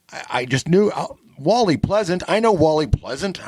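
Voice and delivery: high-pitched, excited retelling of a sudden realisation; excited recognition. Retold or imitated. Host recalling the moment he recognised [name], excited. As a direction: pitch leaps up on recognising the name.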